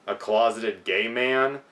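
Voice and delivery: usual speaking voice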